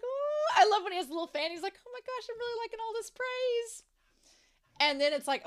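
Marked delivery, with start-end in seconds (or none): 0.0-0.6 high, pleased ooh; delighted ooh. Retold or imitated. Shin basking in the crowd's praise, high delighted ooh. 1.8-3.8 high, pleased put-on voice; smug delight. Shin relishing the cheering, high smug gush.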